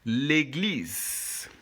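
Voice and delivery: "L'église" as lay-gleez, last E silent; "L'église" modelled clearly, lay-gleez; French model pronunciation. Shown as a demonstration. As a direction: draw out the final z of "L'église". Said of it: "this is how we pronounce the word".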